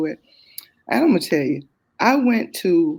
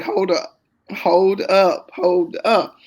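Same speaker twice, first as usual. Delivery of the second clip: louder, emphatic mock hold up; realizing the daughter said the same thing, a louder hold up; 2 semitones higher, 7 dB louder, much slower than usual. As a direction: stop everything, it just hit you. Stopped cold by the prophecy.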